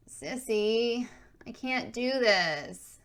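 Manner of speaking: high, slow whining plea. Acted. Little sister pleading with her big sister, whining. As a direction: whine it out like a reluctant kid. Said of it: "Adrian whined".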